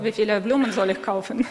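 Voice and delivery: ordinary delivery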